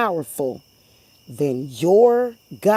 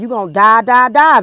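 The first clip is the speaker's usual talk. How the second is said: loud, higher chant of die; quoted warning; 5 semitones higher, 10 dB louder than usual. Retold or imitated. Vlogger quoting her warning to stalkers, loud chant. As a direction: hammer the repeated die. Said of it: "I be coming on here telling them".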